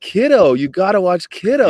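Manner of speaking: high, loud and insistent; retold enthusiastic urging; excited recommendation. Recommending a horror movie to a friend, loud and excited.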